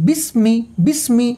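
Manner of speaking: বিসমি recited carefully with an unstretched মি; measured বিসমি, final vowel not held; no stretching. Shown as a demonstration. Quran teacher showing the quick kasra reading, no stretching. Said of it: "কোনো টান হবে না".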